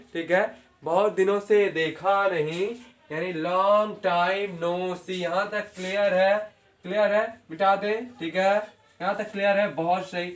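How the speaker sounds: usual speaking voice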